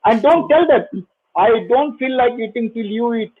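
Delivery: ordinary talk, nothing marked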